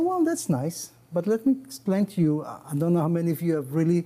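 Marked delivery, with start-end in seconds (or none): 0.1-0.8 high, breezy mock shrug on that's nice; mock dismissive. Imagined listener shrugging off the desert story, mock dismissive. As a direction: toss it off like a bored listener. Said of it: "And you say".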